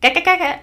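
high, loud mimicked animal chatter; critter chatter imitation. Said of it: "it just kinda does this little".

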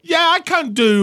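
high, loud hammed-up British; British accent. Joining co-host's bit, mock British accent. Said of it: "Can you do a British accent?".

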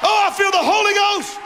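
high, insistent pulpit shout; full-force preaching. Acted. Preacher urges church to God's house, shouted preaching.

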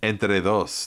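"Entre Dos" said simply, Spanish "tr" and "dos"; just says "Entre Dos" without fuss; plain Spanish. Shown as a demonstration. Co-host showing that the name is easy, plain Spanish. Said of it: "It's just".

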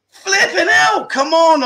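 high, exasperated groan of complaint; exasperated outburst. Real, not acted. The streamer complains about his co-host's pressure, exasperated outburst. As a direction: throw your hands up, really fed up.

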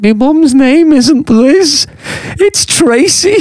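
high-pitched, drawn-out disbelief; bewildered denial. Retold or imitated. Son corrects supposed dad on mum's name, high-pitched bewilderment. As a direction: imitate a baffled son, voice shooting right up.

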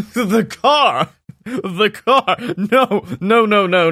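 high, laughing wild cackle through the words; maniacal laugh. A narrator's reading. Gandalf mocking Sammy's car idea, laughing maniacally. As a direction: throw the head back and laugh. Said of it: "laughing maniacally".

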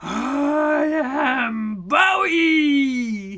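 slow, loud beastly growl. Acted. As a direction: growl your name out slowly. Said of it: "he growled".

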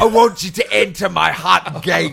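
comic battle-cry voice; mock warrior-king impression. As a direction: roar it like an over-the-top movie hero. Mock hero impression of the film.

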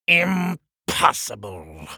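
IMPOSSIBLE drawn out very slowly; seething king. Acted. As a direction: stretch each part of IMPOSSIBLE with a pause. King repeats it, glaring at door.

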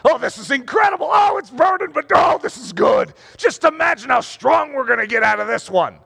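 high, eager exclamations; mock strained delight.

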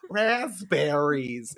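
hugely drawn-out comic impression; Pandora Box's Carol Channing. Impression of a Snatch Game impression, drawn-out Carol Channing. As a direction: stretch the word out in hammed-up Carol Channing style. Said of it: "doing an impression of Pandora Box".

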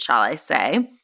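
ordinary talk

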